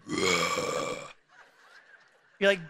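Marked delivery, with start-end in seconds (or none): 0.1-1.0 low guttural labor groan; deep guttural groan of labor. Retold or imitated. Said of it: "It's like guttural".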